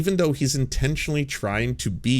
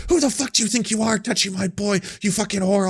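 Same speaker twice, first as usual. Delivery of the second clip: mock angry mom; 9 semitones higher than usual. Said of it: "going off at the sex worker".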